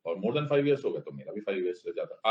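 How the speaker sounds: ordinary talk, nothing marked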